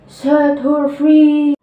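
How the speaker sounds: loud, drawn-out command; commanding villain shout; Captain Hook. A narrator's reading. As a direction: shout the order slowly, stretching the words. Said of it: "In a voice that sounded like Captain Hook".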